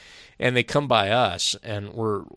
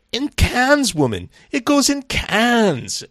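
much higher squeaky voice for the big bodybuilder; high-pitched voice; 7 dB louder, slower than usual. Retold or imitated. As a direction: imitate his surprisingly high voice, pitched way up and slow. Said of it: "he goes in his high-pitched voice".

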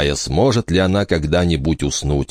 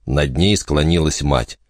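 ordinary delivery

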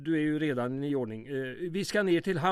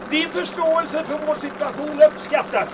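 higher, louder yell over the engine; shouting over wind; 11 semitones higher, 5 dB louder than usual. Acted. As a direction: call out above the rushing wind.

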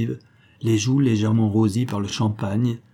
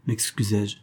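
ordinary delivery

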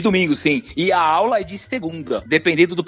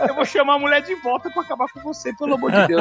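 retold exasperation, higher and laughing; exasperated outburst; 7 semitones higher than usual. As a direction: blurt it out, half-laughing in disbelief. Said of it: "Eu falei".

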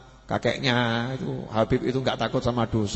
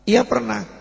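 higher, louder elder's reply; old man answering; 9 semitones higher, 6 dB louder, slower than usual. Retold or imitated. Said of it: "Maka dia jawab". (orang tua menjawab, lebih tinggi)

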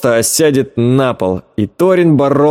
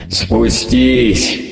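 monster mutter; 2 semitones lower than usual. Acted. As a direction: mutter it like a lurking creature. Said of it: "пробормотал голос".

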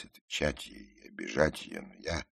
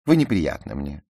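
higher, loud offended rebuff; indignant; 5 semitones higher, 10 dB louder than usual. A narrator's reading. Ruth tells the narrator off, indignant. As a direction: push them away in affront. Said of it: "возмутилась она".

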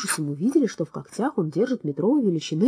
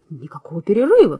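loud, sharp refusal; shouting judge; 9 dB louder than usual. A narrator's reading. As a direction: cut him off with a shout. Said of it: "крикнул судья".

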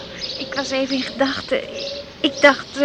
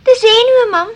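loud nervous blurt; 7 semitones higher, 10 dB louder than usual. Acted. Daughter tells her mother it's just nerves, blurted loudly. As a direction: burst it out, high and loud.

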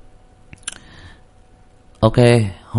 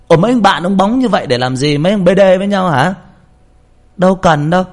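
higher, loud mocking wife voice, pitched up throughout; nagging wife; 9 semitones higher, 5 dB louder than usual. Wife scolding husband for dressing up to go drinking, shrill-free high mock nag. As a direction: nag him like a suspicious wife.

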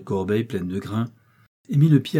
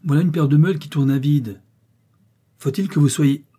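louder, higher scolding; irritated; 5 semitones higher, 7 dB louder than usual. A narrator's reading. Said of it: "il fit un geste irrité".

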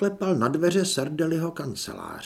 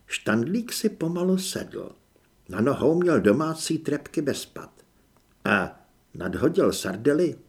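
plain reading voice

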